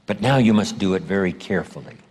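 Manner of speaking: ordinary delivery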